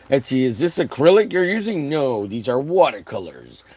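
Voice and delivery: usual speaking voice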